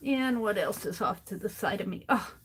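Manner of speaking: ordinary talk, nothing marked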